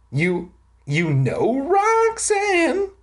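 high, slow, stunned stutter; sputtering. A narrator's reading. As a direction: trip on the first word, then stretch it high. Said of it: "I sputtered".

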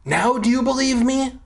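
high-pitched, excited calling out; excited exclamation. A narrator's reading. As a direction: read it as a kid urgently showing off proof. Excited character calls out discovery.

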